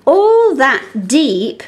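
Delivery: slow, deliberate line; slow reading. Shown as a demonstration. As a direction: read it slowly to spot the E. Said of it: "Let's look for that E sound".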